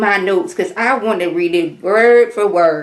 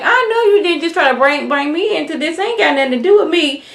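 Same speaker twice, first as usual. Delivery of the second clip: higher, offended pushback; indignant; 8 semitones higher than usual. Retold or imitated. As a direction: brush off the accusation, high and put-upon. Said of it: "Portia, like".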